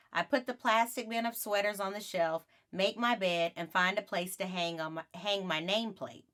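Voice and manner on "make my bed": usual speaking voice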